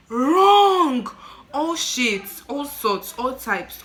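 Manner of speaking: loud, high, drawn-out outrage; indignant condemnation. Retold or imitated. Condemning families who won't bury their children, indignant. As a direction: hit each phrase hard, outraged. Indignant about abandoned babies.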